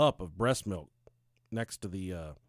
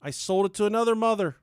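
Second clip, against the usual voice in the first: high, loud storyteller's proclamation; hammed-up, projected narration; dramatic declaiming; 9 semitones higher, 6 dB louder than usual. Real, not acted. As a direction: proclaim it like an epic tale.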